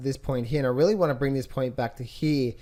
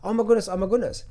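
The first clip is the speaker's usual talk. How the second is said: quick, higher-pitched imitation of panic; mock freak-out; 5 semitones higher, faster than usual. Traders reacting to a pullback, mock freaking out. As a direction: rattle it off like a fretting trader. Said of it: "they're freaking out because they're like".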